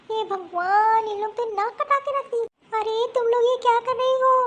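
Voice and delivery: high, loud dismayed scold; mother-in-law yelling at daughters-in-law; shocked scolding. Acted. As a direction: break up the fight in outrage.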